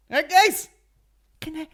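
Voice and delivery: high, loud mock call to the room; mock shout. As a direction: call out over the interruption, half-joking. Mock-calling the people interrupting him.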